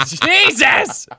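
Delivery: high startled cry of Jesus; shocked yelp. Retold or imitated. Narrator reacting as friend hits the door, startled yelp. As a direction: blurt it out in alarm.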